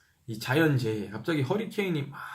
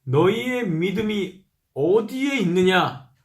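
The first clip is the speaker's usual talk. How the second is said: rebuking teacher; 6 semitones higher, 5 dB louder, slower than usual. Acted. 예수의 책망 낭독.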